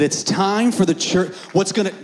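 high, loud, fired-up sermon delivery; excited preaching. Real, not acted. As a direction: preach at full force, rousing the church. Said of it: "I, I, I get excited".

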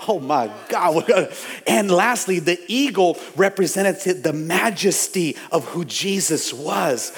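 ordinary talk, nothing marked

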